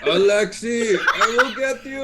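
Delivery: mock villain vow; Babinski threat. Babinski shouting after the escaping Alexei. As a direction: vow revenge, hammed up.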